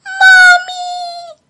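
very high, loud crying call; wailing for mommy. A narrator's reading. Crickets cry out loud for their mother, high wailing. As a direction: wail for mommy in tears. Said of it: "brečeli na celé kolo a volali".

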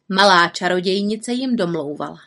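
ordinary delivery

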